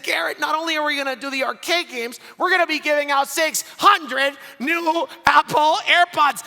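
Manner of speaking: high, building to a loud hyped announcement; retold comeback swelling into a shout; triumphant retort. Retelling his triumphant retort, building.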